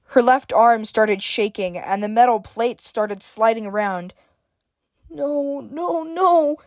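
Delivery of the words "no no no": whimpering. A narrator's reading. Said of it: "Evelyn whimpered".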